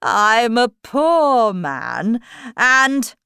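slow, loud, drawn-out plea; Hatter. Acted. Hatter pleading with the king, slow loud plea. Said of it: "the Hatter went on".

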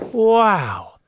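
loud, high, amazed cries; excited shout. Acted. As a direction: gush loudly over the cloth. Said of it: "They shouted".

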